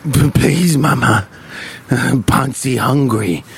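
put-on low, slow German-accented begging. Ponce begging mama to be fed, in a German accent. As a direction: plead like a German-accented mama's boy. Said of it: "I don't know why he's German".

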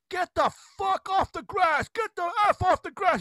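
high-pitched imitation of an angry yell; drawn-out, high retold holler; mock scream. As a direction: act out the old man screaming, without full volume. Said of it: "He's screaming at us".

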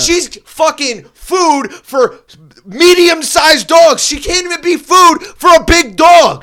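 high, loud slow yelling; furious rant. Real, not acted. Ranting to his friend about the tiny dog, shouting. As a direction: scream it out in disbelief.

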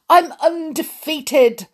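high, loud rasping crow of victory; raspy victorious queen; triumphant rasp. Acted. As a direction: gloat through a sore throat. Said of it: "the Queen rasped triumphantly".

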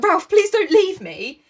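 high, slow mock pleading; pleading Piggy. Piggy begging Ralph not to go, high mock plea. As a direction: beg in a high little voice. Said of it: "Piggy is like".

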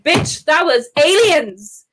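drawn-out, high sassy put-down; sassy retort. Retold or imitated. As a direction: snap back at granny with mock attitude. Mocking granny's religious story.

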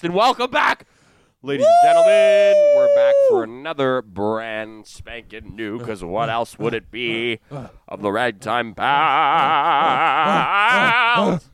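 booming show-host welcome, way up high on ladies; big over-the-top host welcome; announcer intro. Acted. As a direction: hype the crowd like a game-show host.

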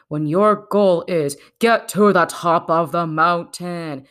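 ordinary delivery

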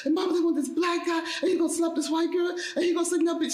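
high, pleading, upset retelling of the call; a touch of the tears she felt, voice pitched high; retold tearful plea. Calling her mom from outside the writers' room, high and upset. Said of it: "in tears, like".

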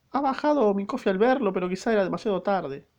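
maid voice. Acted. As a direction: explain in a small high voice. Maid explains.

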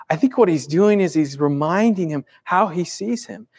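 usual speaking voice

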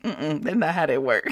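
low, soft amused refusal; mock dismissal. The Lord correcting her prayer, quiet dismissal. Acts out Lord's dismissive answer.

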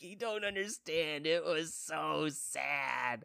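high, drawn-out weepy whine; mock crying. Husband pleading with unimpressed wife, mock crying. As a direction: wail it slowly, playing up the tears. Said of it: "you're crying about".

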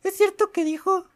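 high, rising girly mock voice; imitating the girl. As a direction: squeak it like a gossiping schoolgirl. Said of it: "Bueno, así hablaba".

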